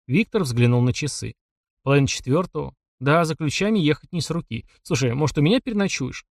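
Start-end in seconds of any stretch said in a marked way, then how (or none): none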